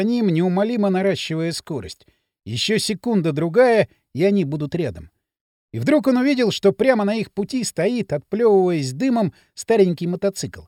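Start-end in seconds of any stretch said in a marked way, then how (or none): none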